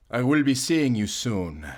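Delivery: ordinary talk, nothing marked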